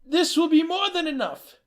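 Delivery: a hint of a stunned grandson; stammered thanks as the pretend grandson; young man's voice. Man posing as grandson.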